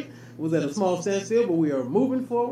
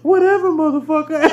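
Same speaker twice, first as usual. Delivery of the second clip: put-on sassy girlfriend; 7 semitones higher, 7 dB louder than usual. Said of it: "she hit him with the".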